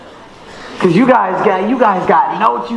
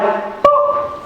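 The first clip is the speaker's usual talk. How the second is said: whispered beep. Retold or imitated. GPS sound effect.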